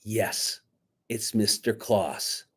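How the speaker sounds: plain reading voice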